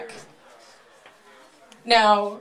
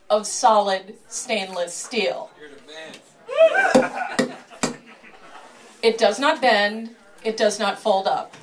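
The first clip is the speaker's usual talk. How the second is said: showman patter. Acted. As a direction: sell it like a sideshow barker. Showman presents the sword.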